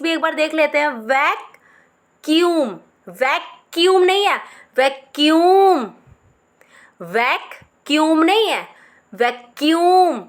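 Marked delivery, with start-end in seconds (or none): 4.8-5.9 vacuum slow with a loud push; slow stressed. Shown as a demonstration. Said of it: "एक साथ बोलना है". 9.1-10.2 slow stressed. Shown as a demonstration. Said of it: "एक साथ बोलना है".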